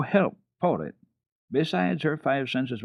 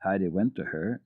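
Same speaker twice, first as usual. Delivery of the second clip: usual speaking voice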